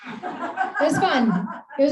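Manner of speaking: slow, high, laughing through the words. Real, not acted. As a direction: let the laugh carry it, drawn out. Laughs at weird reunion memory.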